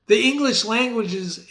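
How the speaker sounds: ordinary talk, nothing marked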